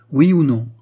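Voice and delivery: demanding queen. A narrator's reading.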